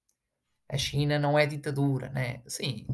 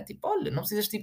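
ordinary delivery

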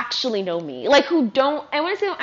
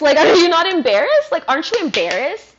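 higher and louder, hammering the question; exasperated outburst; 7 semitones higher, 7 dB louder than usual. Real, not acted. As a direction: throw the question at them, fed up. Repeats the jab, louder.

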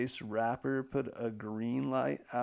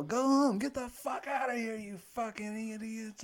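high-pitched mock yell that drops in volume; 9 semitones higher than usual. Comic yelling at people to make them leave, high mock yell. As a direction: imitate a heckling comic's yell without full volume. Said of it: "he yells at people".

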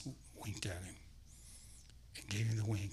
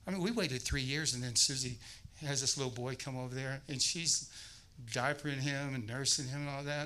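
normal talking voice

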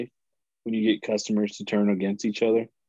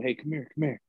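hushed call; 2 semitones higher, 7 dB quieter than usual. Retold or imitated. Worker calls customers at the fence, hushed. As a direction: call them over softly, on the sly. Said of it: "we'd call them".

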